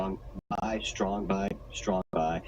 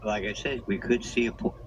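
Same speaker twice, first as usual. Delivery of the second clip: usual speaking voice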